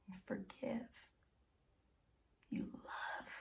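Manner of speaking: soft breathy whisper of an answer; hushed answer, barely voiced. Real, not acted. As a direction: drop to a whisper, gently. Hushed answer after a question.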